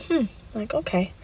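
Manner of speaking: quiet. Retold or imitated. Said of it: "And I thought".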